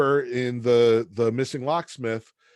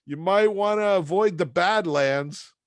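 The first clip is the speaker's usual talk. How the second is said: much higher, chipper salesman voice; cheery Joe; 7 semitones higher than usual. Retold or imitated. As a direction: sell Milwaukee like a booster. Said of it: "He's like".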